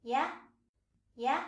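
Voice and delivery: short questioning "yeah", swept upward; rising tone. Shown as a demonstration. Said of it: "try using yeah".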